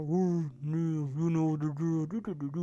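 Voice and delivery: muffled, distant background vocal. Retold or imitated. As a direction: imitate muted vocals fading into wordless doo-doo. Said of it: "[name] is very far in the background".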